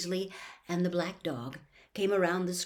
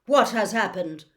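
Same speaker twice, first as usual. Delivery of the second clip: louder, sharp demand; sharply; 2 semitones higher, 10 dB louder than usual. Acted. Dumbledore demanding answers, sharply. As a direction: cut in with authority. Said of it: "said Dumbledore sharply".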